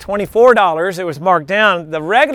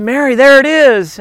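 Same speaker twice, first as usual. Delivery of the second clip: louder, higher, pleased find; excited discovery; 5 semitones higher, 7 dB louder than usual. Retold or imitated. Dad spots the backpack for his daughter, excited. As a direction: point it out with a lift of excitement.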